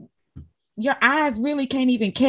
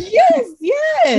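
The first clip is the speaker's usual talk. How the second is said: laughing yes; 5 dB louder than usual. Real, not acted. Laughing agreement with host.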